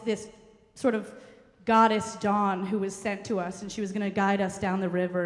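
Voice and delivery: ordinary talk, nothing marked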